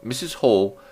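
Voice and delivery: plain reading voice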